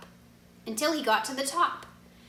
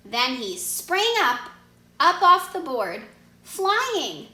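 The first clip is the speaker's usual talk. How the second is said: climbing higher and louder into flying; soaring excitement; 5 semitones higher, 5 dB louder, slower than usual. A narrator's reading. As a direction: build up as he springs into the air. Narrating the leap upward.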